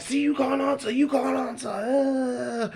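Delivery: high, loud taunting outburst; imitating angry shouting. Heckler claims he can't answer, angry high shouting. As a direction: crow over him in a mock shout. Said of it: "Why are you shouting for".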